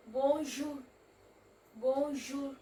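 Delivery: bonjour said twice with a French ou and a rising end; French ou sound. Shown as a demonstration. Teacher demonstrating the ou sound in bonjour. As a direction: round the lips for the ou in bonjour.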